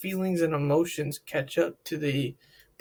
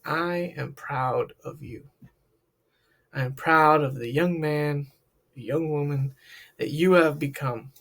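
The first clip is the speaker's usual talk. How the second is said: heartfelt; 3 semitones lower than usual. Shown as a demonstration. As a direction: say it like you truly mean every word. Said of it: "heard it in a tone like that".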